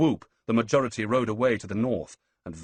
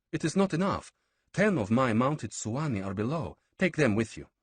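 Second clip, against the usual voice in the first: a hint of a higher, firm noblewoman; lightly voiced queen; 6 semitones higher than usual. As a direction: urge him to take her men. Pithanissa voiced by narrator.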